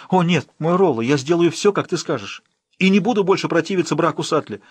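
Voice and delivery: high, loud frantic plea; desperate pleading. Acted. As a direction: beg him before he decides. Emma desperately pleads for Guy.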